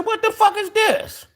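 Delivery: high-pitched shocked squeal; outraged disbelief. Retold or imitated. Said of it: "I said".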